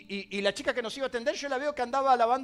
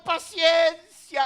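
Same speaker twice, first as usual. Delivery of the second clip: drawn-out patience; 5 semitones higher, 8 dB louder, much slower than usual. Acted. Pastor answers impatient church member.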